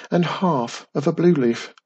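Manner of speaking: plain reading voice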